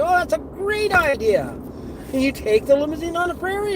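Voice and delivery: high-pitched, eager group reaction; excited passengers. Retold or imitated. Clients react keenly to his idea.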